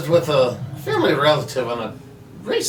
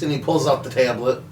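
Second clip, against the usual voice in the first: ordinary delivery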